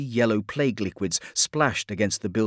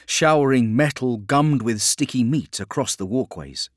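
usual speaking voice

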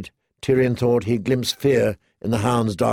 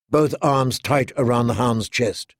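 ordinary delivery, nothing marked

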